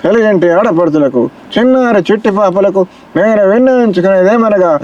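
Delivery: lightly voiced film hero giving a speech; Krishna impression. Shown as a demonstration. Mimic shows Krishna giving the same political speech, lightly voiced. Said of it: "Krishna గారు చెప్పారు అనుకో".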